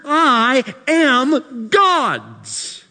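I am God's, stretched out slow and high; slow emphatic declaration. Acted. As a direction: land each word slowly.

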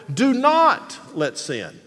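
"not" high and forceful, then a pause; stress on NOT. Shown as a demonstration. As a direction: lean hard on not. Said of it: "emphasize a different word every time".

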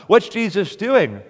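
loud, high, ringing preacher's cry; full-force preaching. Acted. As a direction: thunder it from the pulpit, slowing on key lines.

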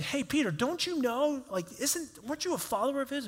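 high-pitched acted questioning; curious bystanders' questioning. Bystanders confront Peter in retold story.

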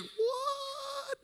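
very high, drawn-out squeak; mock disbelief. Reacting to sister's creepy chant, squeaky disbelief. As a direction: squeal it in stretched-out bafflement.

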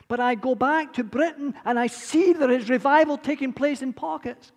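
loud, high, impassioned preaching; preaching at full force. Real, not acted. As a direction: pour it out to the congregation.

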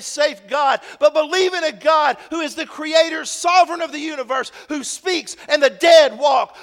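loud, high fervent preaching, building to a shout; preaching at full force. Acted. Pastor rousing the congregation, loud fervent preaching. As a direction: thunder it from the pulpit.

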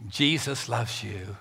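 soft, slow and tender; hushed slow blessing. Acted. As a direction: say it gently to someone who hurt you. Tender words to an enemy.

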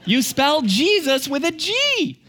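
put-on shrieked, very high little-girl voice; squealed, drawn-out kid voice; insistent toddler. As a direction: play a toddler yelling to insist. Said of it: "She goes".

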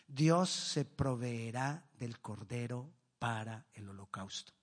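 Abraham answering his son with calm faith, hushed and slow; solemn quiet answer of faith. Acted. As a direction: let it sink to a reverent hush, word by word. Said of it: "Y respondió Abraham".

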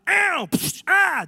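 sharp mock yells while hunting; imitating yelling. Preacher imitating his friend yelling during a hunt, mock yells. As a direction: yelp like a friend shooting doves. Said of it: "He yells the whole time".

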